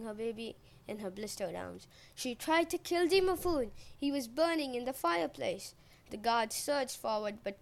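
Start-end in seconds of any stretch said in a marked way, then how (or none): 2.1-5.7 high, loud, distraught outcry; shrieking accusation. A narrator's reading. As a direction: accuse her at full voice. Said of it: "Matanaria shrieked".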